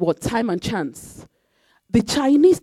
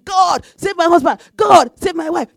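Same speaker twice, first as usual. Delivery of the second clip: higher, louder imitation of a desperate plea; a hint of a tearful plea; mock pleading prayer; 8 semitones higher, 6 dB louder than usual. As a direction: voice the spouse begging God, lightly. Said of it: "you are crying".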